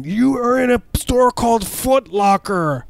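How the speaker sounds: high, slow, shrieking mock yell; mock scream. Store clerk screaming at a trapped customer, high mock yell. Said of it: "scream at your face as loud as they can".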